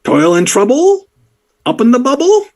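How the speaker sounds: sing-song the rhyme like a tiny goblin. Acted. Goblin ticket-taker quizzing a visitor, high squeaky rhyme. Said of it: "he'll, like".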